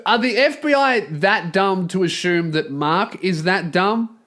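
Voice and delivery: ordinary talk, nothing marked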